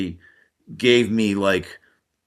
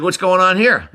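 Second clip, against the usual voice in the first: pitched higher and louder, a mock take-charge boom; imitating a commanding doctor; 9 semitones higher, 5 dB louder than usual. Surgeon walking in on the doctors, mock-commanding higher and louder. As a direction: ham up the big doctor striding in. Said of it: "he's like".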